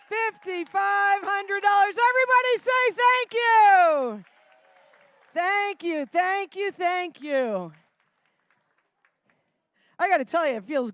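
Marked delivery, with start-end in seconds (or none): 0.1-7.7 lively auction calling; rally the room for bids; auctioneer. Acted. Live charity auction calling.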